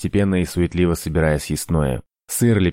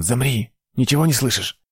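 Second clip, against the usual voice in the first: higher, tense alarmed question; 6 semitones higher than usual. A narrator's reading. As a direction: freeze and listen, alarmed. Hearing pursuers in tunnel.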